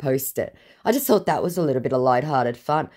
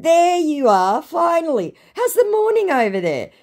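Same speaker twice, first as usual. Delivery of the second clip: high, sing-out sarcastic welcome; mock greeting; 12 semitones higher, 4 dB louder than usual. Greeting an exposed scammer, mock delighted. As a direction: greet them with exaggerated high-pitched relief.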